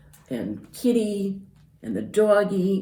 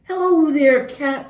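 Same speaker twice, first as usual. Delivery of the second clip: high, louder duck greeting; cheery Duck; 11 semitones higher, 8 dB louder than usual. Acted. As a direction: sing out hello as Duck. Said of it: "said Duck".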